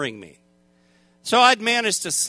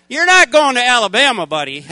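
louder, blunt mock-doctor verdict; 4 semitones higher, 9 dB louder than usual. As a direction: bark it like a doctor ending your plans. Doctor telling him bluntly.

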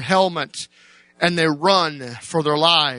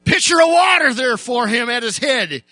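preaching at full force; 9 semitones higher than usual. Acted. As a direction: drive the story home to the church, raised high.

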